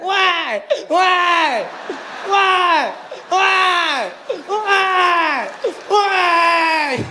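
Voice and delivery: put-on whiny baby wail.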